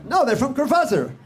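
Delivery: mock insistent correction. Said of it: "they'll mean like".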